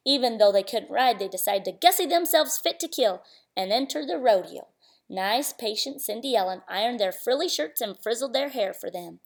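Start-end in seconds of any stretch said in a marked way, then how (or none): none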